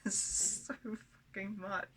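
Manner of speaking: overwhelmed laughter breaking into speech; real incredulous laughing. Real, not acted. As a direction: laugh helplessly at how much it all is. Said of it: "I'm laughing because".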